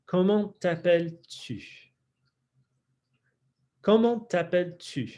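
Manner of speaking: French accent with lips pushed forward on tu. Shown as a demonstration. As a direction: imitate French accent on comment t'appelles-tu. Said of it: "imitate that French accent".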